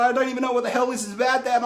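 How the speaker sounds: high and fast, giggly protest; laughing disbelief. Retold or imitated. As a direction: blurt it out half laughing at dad. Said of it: "She's like".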